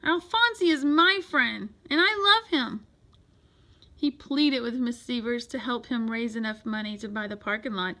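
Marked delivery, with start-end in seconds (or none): 0.0-2.9 loud, high declaration; impassioned cry. A narrator's reading. Boy defending his beloved tadpole, impassioned cry. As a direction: declare it with full feeling. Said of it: "cried Louise".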